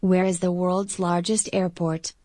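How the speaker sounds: ordinary talk, nothing marked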